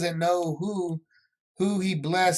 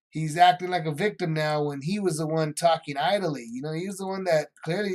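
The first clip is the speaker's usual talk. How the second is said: ordinary talk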